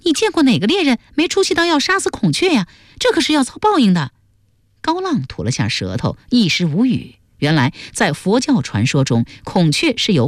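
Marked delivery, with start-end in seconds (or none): 0.0-4.1 high, fast female scolding; rapid, higher-pitched rebuke; glaring reproach. A narrator's reading. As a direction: tell him off indignantly. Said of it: "瞪他一眼说".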